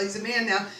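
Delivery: usual speaking voice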